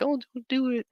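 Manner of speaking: high-pitched imitation of a pleading parent; high mock parent. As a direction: squeak it out like a fussy parent.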